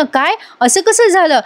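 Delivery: the worried father reacts in shock; shocked disbelief. Retold or imitated. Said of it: "शुभमकरांना tension आलं".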